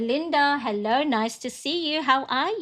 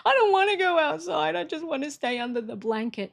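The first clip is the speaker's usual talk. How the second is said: sulky refusal; 6 semitones higher than usual. Retold or imitated. Wife whining back at husband who says go outside. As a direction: whine it like a child who won't budge.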